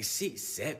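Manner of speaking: ordinary talk, nothing marked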